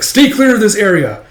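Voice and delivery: high, loud warning call; shouted warning. Acted. Soldier warns others as the dragon thrashes, shouted. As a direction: warn everyone off loudly.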